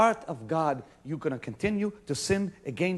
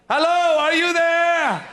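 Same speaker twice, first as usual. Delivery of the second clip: high, loud, slow wake-up call; 10 semitones higher, 8 dB louder, slower than usual. Acted. Preacher loudly calls out to see if the crowd is listening. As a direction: stretch out there like knocking on a door. Calling out to the congregation.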